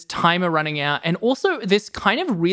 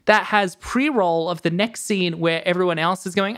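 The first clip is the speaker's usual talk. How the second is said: ordinary talk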